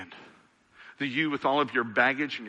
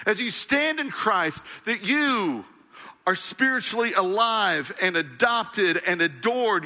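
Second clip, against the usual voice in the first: raised-pitch, emphatic proclamation; fervent preaching; 7 semitones higher than usual. Real, not acted. Preacher reminding believers who they are in Jesus, fervent preaching. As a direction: drive each truth home to the congregation.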